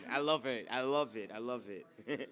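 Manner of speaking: normal talking voice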